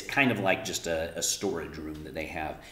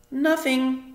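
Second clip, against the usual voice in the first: put-on higher young girl's voice; girl's reply; 8 dB louder than usual. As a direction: answer quickly like a little girl. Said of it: "she said".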